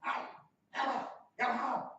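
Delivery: loud, high imitated street shout; full-voiced hostile holler, acted out; screamed heckle. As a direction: yell it down the street at a stranger. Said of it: "I heard someone scream out".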